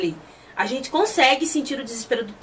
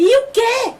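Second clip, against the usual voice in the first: much higher, louder mock shout; retold shocked yell; 10 semitones higher, 5 dB louder, slower than usual. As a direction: act out a startled outburst. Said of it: "Ele dá um grito".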